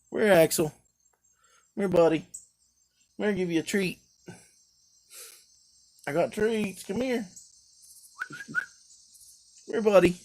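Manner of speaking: loud high calls to the dog; calling the dog. Real, not acted. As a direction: coax the dog in with treats.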